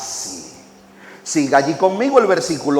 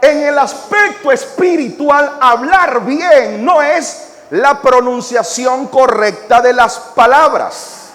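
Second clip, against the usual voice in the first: louder, higher, fiery pulpit delivery; full-force preaching; 9 semitones higher, 4 dB louder than usual. Acted.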